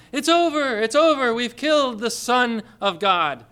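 high, loud gloating spirits; jeering demons. Retold or imitated. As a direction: taunt him like triumphant demons. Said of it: "jeering at him and saying".

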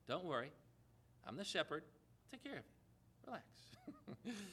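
softly reassuring, dropping almost to a hush; calm reassurance. Acted. Jesus reassures worried disciples, soft and calm. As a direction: soothe frightened followers as their shepherd.

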